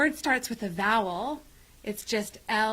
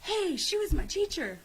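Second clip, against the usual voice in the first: put-on excited kid; 9 semitones higher than usual. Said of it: "excited pupil quoted".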